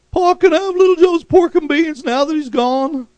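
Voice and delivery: high, loud hammed-up whiny voice; comedy sketch character. Preacher retells a comedy bit in a high silly voice. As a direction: ask Pa greedily like a cartoon brother. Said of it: "Haas said".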